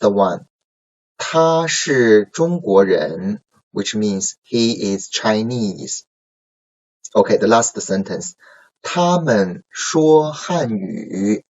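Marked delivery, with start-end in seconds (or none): 1.2-3.4 他是中国人 read slowly and clearly; slow model reading. Shown as a demonstration. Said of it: "let's read together these sentences". 8.8-11.4 他们说汉语 read slowly and a bit higher; slow model reading. Shown as a demonstration. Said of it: "let's read together these sentences".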